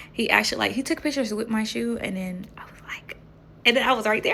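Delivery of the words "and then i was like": unvoiced whispered aside; soft whisper. Real, not acted. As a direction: drop to a whisper, confiding. Hushed aside in story.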